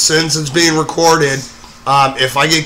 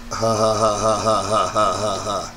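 low, soft mock laugh at his own joke; fake laugh; 5 semitones lower, 7 dB quieter than usual. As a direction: ham up a deadpan fake laugh. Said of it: "that's a stupid joke".

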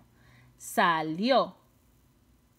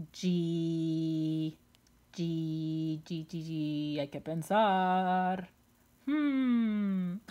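low, drawn-out pondering monotone; slow, softer thinking-aloud drone; musing aloud; 5 semitones lower, 4 dB quieter, much slower than usual. Pretend. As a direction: stretch it out as if searching for a word. Pretends to think of a word.

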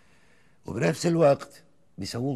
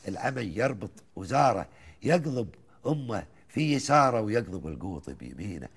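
chanted Gulf verse, rising and falling lines; poem recitation; 2 semitones higher, 4 dB louder than usual. Acted. Host listens as he recites the old poem, measured and rhythmic. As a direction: recite it like a folk poet to the host.

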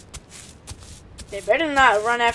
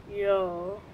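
ordinary talk

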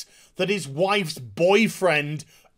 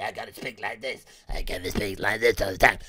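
very low, forced vigilante voice; Batman; 9 semitones lower than usual. Retold or imitated. Reviewer mocking an actress copying a superhero, low impression. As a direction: push the voice right down into a mock growl. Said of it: "impression of Batman at all times".

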